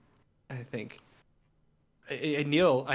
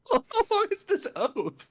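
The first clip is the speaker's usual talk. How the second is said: high, incredulous aside; exasperated squeak; 4 dB louder than usual. Real, not acted. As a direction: complain the oath drags.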